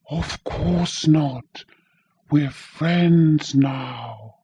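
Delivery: the wind as a warm friend; slow loud friendly wind. A narrator's reading. Wind speaks kindly.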